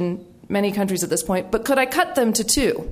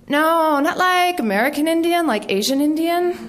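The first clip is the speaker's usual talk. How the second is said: high, emphatic correcting voice; mock clarification; 7 semitones higher than usual. Acting someone explaining which Indian is meant, mock clarification. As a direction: clear up a confusion in a raised, questioning voice. Imagined exchange clarifying an odd term.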